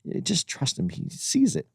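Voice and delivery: a hint of Kingsley's quiet calm; quoting Kingsley softly. Kingsley reassuring Neeson about Spielberg, soft mock calm. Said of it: "Ben Kingsley ... said".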